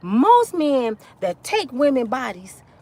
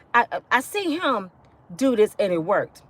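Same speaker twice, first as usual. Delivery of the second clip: ordinary talk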